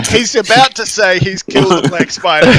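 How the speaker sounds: can barely keep a straight face; laughing aside. Acted. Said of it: "and I go".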